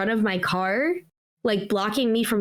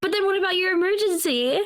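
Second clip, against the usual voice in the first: incredulous question; 7 semitones higher than usual. Real, not acted. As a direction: ask it high, pressing the point. Laughing challenge after dramatic joke.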